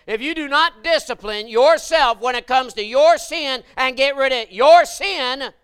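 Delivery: loud, high and slow preacher's holler; full-force preaching. Acted. Preacher warning the church about sin, slow loud holler. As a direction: hammer each phrase at them.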